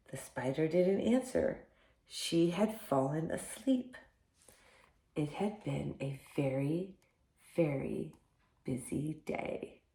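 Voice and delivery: soft, sleepy storybook close; hushed story ending. A narrator's reading. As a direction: let it drift down quietly as the spider sleeps. Bedtime ending, spider fallen asleep.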